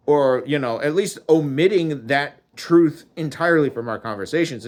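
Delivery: normal talking voice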